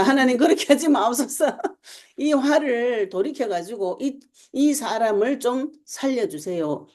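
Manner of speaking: high-pitched begging for mercy; urgent mock intercession, pitched well up; acted pleading. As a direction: plead on someone's behalf, drawing the words out. Acts out an intercessor begging God.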